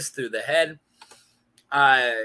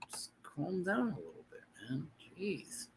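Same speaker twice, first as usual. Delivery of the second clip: quiet mock aside; 18 dB quieter than usual. Telling an imagined viewer to calm down, softly. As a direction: hush someone gently under your breath.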